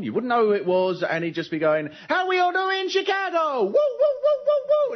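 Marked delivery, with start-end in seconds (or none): 2.1-4.9 high-pitched whooping American stand-up greeting; hype-man opener with big high woos; American comedian. Acted. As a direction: work the crowd with shrill whoops, way up high. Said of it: "a comedian would have just come on".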